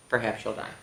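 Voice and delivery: soft, low ominous aside; hushed. A narrator's reading. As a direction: drop to a mock-grim hush. Refrain dropped to a hush.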